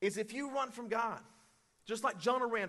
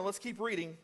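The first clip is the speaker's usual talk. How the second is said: normal talking voice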